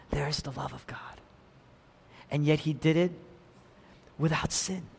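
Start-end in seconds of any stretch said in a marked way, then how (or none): 0.1-1.2 whisper. Real, not acted. Hushed sermon climax.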